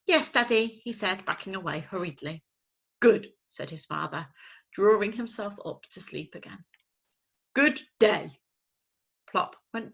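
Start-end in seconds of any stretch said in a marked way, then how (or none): 0.1-0.8 high, loud little owl insisting; insistent owlet. Acted. Owlet insisting his father spoke. 3.0-3.3 loud, slow owl's grumble; grumpy father owl. Acted. Father owl grumbling at his chick. 7.5-8.3 loud, slow owl's grumble; grumpy father owl. Acted. Father owl grumbling at his chick.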